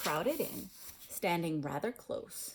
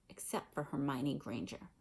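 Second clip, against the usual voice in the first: usual speaking voice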